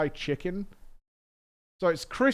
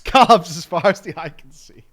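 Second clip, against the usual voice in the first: laughing loudly; 2 semitones higher, 13 dB louder than usual. Real, not acted. Laughing, loud at menu.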